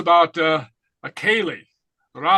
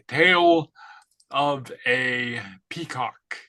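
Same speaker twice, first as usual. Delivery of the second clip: slow; 4 semitones lower, much slower than usual. Shown as a demonstration. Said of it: "teacher spells out phrase slowly".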